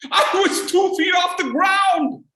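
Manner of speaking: laughing loud punchline; loud high disbelief at himself. Real, not acted. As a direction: belt out the absurdity.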